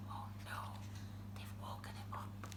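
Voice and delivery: very soft near-whisper; hushed dread. Acted. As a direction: whisper it so the bear won't hear. Dreading that the bear wakes.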